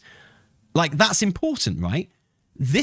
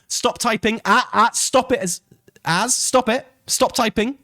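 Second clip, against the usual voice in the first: exasperated commands; 7 semitones higher than usual. Real, not acted. Streamer ordering chatter to stop.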